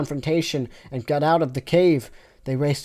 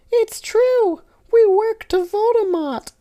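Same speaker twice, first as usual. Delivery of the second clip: very high squeaky Teletubby voice; squeaky Tinky Winky; 5 dB louder, slower than usual. Acted. Tinky Winky explaining to Snape, very high and squeaky. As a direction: pitch way up like a toy.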